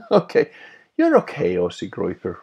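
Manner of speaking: laughing okay. Real, not acted. Laughing approval of a viewer.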